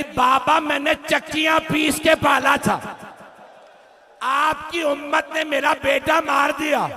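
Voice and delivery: high, grieving appeal to her father; mother's lament. Acted. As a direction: cry out the complaint as a bereaved mother. Mother laments slain son to father.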